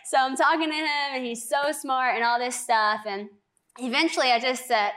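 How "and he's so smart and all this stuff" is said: ordinary talk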